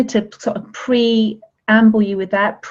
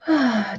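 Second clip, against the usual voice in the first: long relaxing sigh; 2 semitones higher than usual. Shown as a demonstration. Deep breath during meditation.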